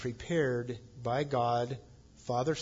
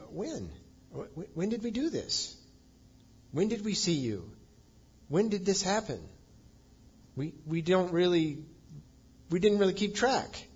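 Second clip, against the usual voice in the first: higher, stammering bewilderment; puzzled questioning; 5 semitones higher than usual. Acted. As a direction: act out people caught off guard, fumbling for an answer. Righteous people's puzzled response, acted.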